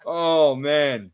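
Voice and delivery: talking through real laughter; amused laughing. Real, not acted. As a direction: let laughs break into the words. Amused after the shouted mic test.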